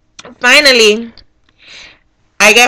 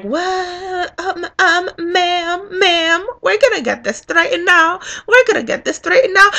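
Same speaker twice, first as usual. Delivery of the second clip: high, soft, whimpering worker imitation; flustered poll worker; 9 semitones higher, 5 dB quieter than usual. Poll worker placating an angry voter, flustered. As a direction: put on a small, high, flustered voice.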